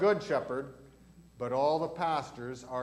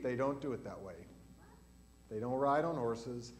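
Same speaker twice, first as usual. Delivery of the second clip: ordinary talk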